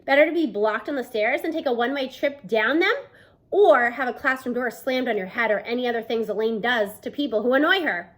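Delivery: ordinary delivery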